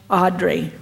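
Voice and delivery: ordinary talk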